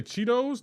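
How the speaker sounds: the incorrect Cheetos, D in the middle. Retold or imitated. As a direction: mimic Cheetos as CHEE-doze. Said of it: "that is incorrect".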